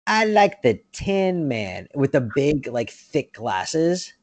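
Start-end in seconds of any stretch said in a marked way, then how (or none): 0.1-1.9 slow, higher mock kid voice; imitating a creepy movie kid. Quoting the creepy kid in line for Santa, slow mock child voice. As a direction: draw it out like an odd child. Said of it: "And she's like".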